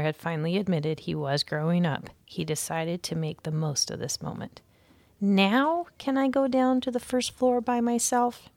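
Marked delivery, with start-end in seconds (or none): 5.2-8.5 put-on higher, eager young mouse asking permission. A narrator's reading. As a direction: plead to go alone, bright and keen. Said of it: "he asked eagerly".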